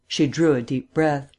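plain reading voice

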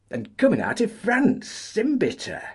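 high, excited miner's cry. A narrator's reading. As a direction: cry it out with glee. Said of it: "cried Chris".

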